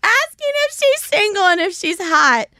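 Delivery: high voice just out of a laugh. Real, not acted. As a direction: squeeze it out through laughter. Still laughing after cracking up.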